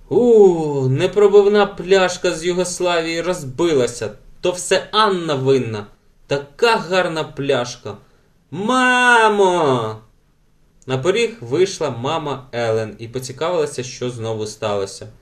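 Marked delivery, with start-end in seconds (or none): 0.1-9.9 put-on high, loud childish wailing; mock sobbing wail. A narrator's reading. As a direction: bawl like a child, building to a drawn-out call for mother. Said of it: "Елен ридала над розбитою пляшкою".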